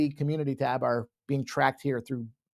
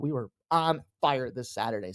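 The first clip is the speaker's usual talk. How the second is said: ordinary delivery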